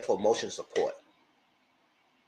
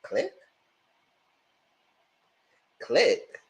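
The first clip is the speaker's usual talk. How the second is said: incredulous; 10 semitones higher, much slower than usual. Real, not acted. Reacting to hang-up.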